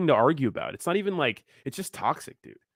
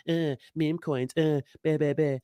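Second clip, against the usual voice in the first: mocking meme coin critics; 3 dB quieter than usual. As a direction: sneer it like a snob who only buys blue chips. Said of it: "People like".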